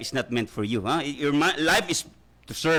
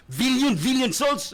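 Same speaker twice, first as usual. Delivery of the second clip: emphatic build; 9 semitones higher, 3 dB louder, slower than usual. Acted. Preacher builds on billion souls.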